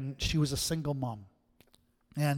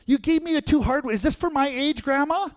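put-on much higher, louder frustrated little girl; whiny child yelling; 8 semitones higher, 7 dB louder than usual. Granddaughter calling from the living room, loud high whine. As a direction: whine and call out like a fed-up kid. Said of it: "And she yells out".

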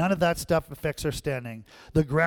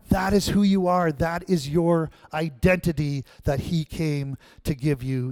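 ordinary talk

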